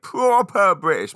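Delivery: over-the-top British catchphrase; hammed-up British. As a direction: ham up the British accent to annoy the kids. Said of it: "My kids hate me doing that".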